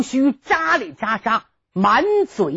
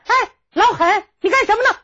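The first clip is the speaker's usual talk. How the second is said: put-on raised, high-pitched young woman's call; high maiden's call; 11 semitones higher, faster than usual. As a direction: call out as a sweet maid. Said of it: "有人喊了一声". (丫鬟娇声喊)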